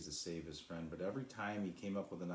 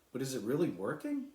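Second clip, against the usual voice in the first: higher, louder eager question; hopeful; 7 semitones higher, 6 dB louder than usual. A narrator's reading. Cloud asking Sly if it works, high and eager. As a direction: ask with rising hope.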